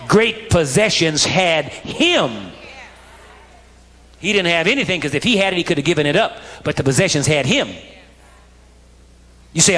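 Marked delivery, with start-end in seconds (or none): none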